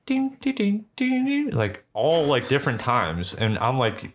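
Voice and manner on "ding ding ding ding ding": high-pitched "ding" noises; imitated chimes. Telling how four trinkets started going off, imitated chimes. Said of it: "they're just like".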